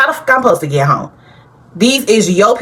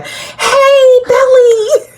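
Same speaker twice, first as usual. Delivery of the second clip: put-on very high, loud sloppy drunk call; hammed-up tipsy shout of her name; drunk greeting; 3 dB louder, much slower than usual. Drunk Jeremiah greeting Belly at party, loud high slur. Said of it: "drunk ass Jeremiah".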